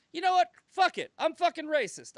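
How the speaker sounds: high, loud mock surrender; fed-up outburst. Accused people eventually giving in, hammed-up fed-up outburst. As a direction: throw up a defiant retort, acted out.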